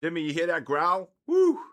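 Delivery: loud, high call ending in a whoop; excited whoop. Real, not acted. Owner telling Jimmy about the dog's growl, loud excited whoop. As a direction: shout it out thrilled.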